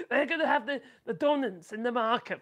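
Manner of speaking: words through a laugh. Real, not acted. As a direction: keep laughing as you talk. Laughing at own joke.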